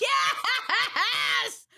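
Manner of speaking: high, laughing yell; whooping laugh on the word; delighted cheer. Real, not acted. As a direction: cheer through a burst of laughter. Cheers a good line, laughing.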